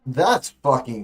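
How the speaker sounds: loud, slow, stunned exclamation; stunned outburst. Real, not acted. Streamer reacts to the president's remark, stunned and loud. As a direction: blurt it out loud and slow in disbelief.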